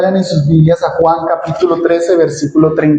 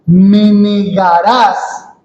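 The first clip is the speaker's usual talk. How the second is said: "Me negarás" drawn out very slowly, each syllable weighted; emphatic Me negarás; much slower than usual. Shown as a demonstration. Preacher stresses "Me negarás" to his congregation, slow and weighty. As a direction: lean hard on "Me negarás", stretching it. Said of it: "esa palabra lleva un énfasis".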